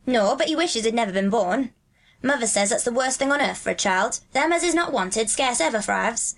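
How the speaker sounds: broad Yorkshire dialect, lilting; Dickon, friendly country lad. Acted. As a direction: chat like a Yorkshire moor boy. Voices Dickon in dialogue.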